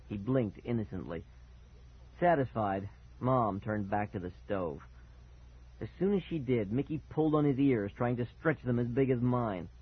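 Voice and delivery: usual speaking voice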